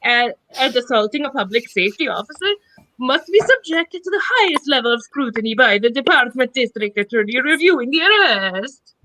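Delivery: put-on a shouted, over-the-top Italian accent; hammed-up Italian accent. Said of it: "I will finish this paragraph in an Italian accent".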